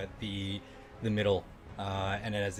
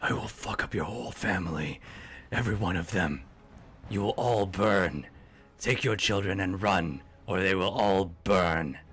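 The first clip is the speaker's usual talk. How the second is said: louder, menacing threat from a creature; menacing monster threat; 5 dB louder than usual. Acted. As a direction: threaten them like an evil beast. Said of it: "but he goes".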